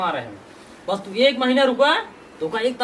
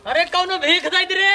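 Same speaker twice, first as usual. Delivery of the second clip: beggar's call; 6 semitones higher than usual. Acted. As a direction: call out to passers-by for alms. Husband calls out begging for alms. (भिखारी जैसी ऊँची पुकार)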